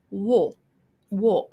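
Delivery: "wo" with a narrow, rounded mouth; narrowed British vowel. Shown as a demonstration. Showing a British regional vowel, "wo" narrowed and rounded. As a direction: close the mouth frame round "wo". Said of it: "it would narrow".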